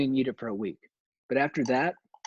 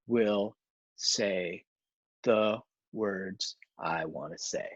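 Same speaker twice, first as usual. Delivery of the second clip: lower, slow, deliberate; slow defiant reply; 5 semitones lower, 5 dB quieter, much slower than usual. Retold or imitated. Host answering the one-word questioner, slow and low. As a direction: drag each word out like a stubborn hero. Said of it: "And I'd be like".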